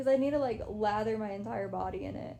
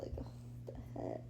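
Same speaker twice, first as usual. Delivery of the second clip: quick whispered aside, fast; 12 dB quieter, much faster than usual. Real, not acted. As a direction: rush it out in a whisper. Whispered aside during live.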